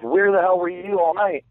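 high, exasperated outburst. Retold or imitated. Himself, upset, quoted.

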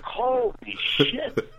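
high, stretched-out soft exclamation; stunned disbelief. Retold or imitated. Storyteller reacting to the friend's bizarre voice, stunned. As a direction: laugh through your amazement.

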